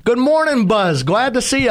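high, sing-song good morning; lilting hello to the water; cheery greeting. Retold or imitated. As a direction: greet the bay like an old friend. Greeting the bay over tea.

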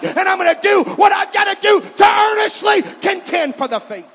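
roaring preacher defying the devil; full-force pulpit roar; shouted preaching. Acted. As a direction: shout it out with all your might.

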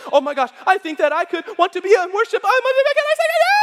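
mock gushing that ends in a loud squeal; excited, climbing pitch. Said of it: "your voice, when you get excited, goes higher and higher".

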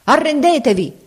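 high, commanding cry; thundering shout. A narrator's reading. Enemy demanding surrender from outside, thundering shout. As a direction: call out a demand, pitched well up. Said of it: "voce tonante gridò".

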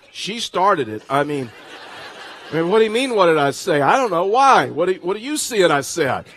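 loud, indignant self-defence played for laughs; defensive protest. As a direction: protest loudly like a caught kid blaming someone. Acted defensive reply to brother.

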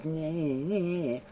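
soft teasing singsong; mock him quietly; taunting nyeh nyeh. Sister taunting brother after mom leaves, soft singsong. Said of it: "The girl goes".

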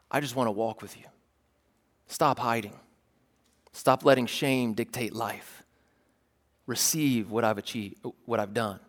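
lightly voiced Jesus, pulling in close; Jesus speaking gently.

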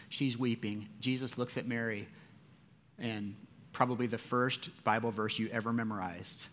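usual speaking voice

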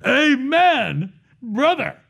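high, drawn-out mock amen call; acted congregational amen. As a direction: imitate an approving crowd calling out, pitch swooping up. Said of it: "They said three amens".

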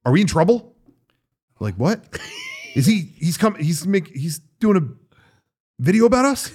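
worried questioning. Retold or imitated. Retelling absent colleague's worries about the guest, mock-worried. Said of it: "he was very like".

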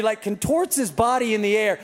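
usual speaking voice

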